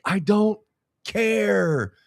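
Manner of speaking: high, drawn-out mock whine; annoyed wife. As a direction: imitate a wife who's had enough. Wife's annoyed reply, acted out.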